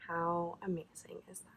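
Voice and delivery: hushed, awed whisper; soft unvoiced marvel. Real, not acted. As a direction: breathe it in wonder. Whispers over a sweet memory.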